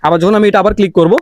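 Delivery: ordinary delivery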